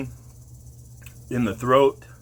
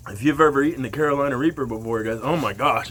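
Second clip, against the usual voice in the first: normal talking voice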